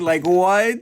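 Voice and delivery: can't believe what he just read; shocked. Real, not acted. Shocked reaction to Otoya's line.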